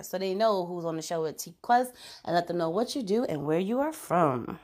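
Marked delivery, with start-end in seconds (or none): none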